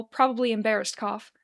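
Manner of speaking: ordinary delivery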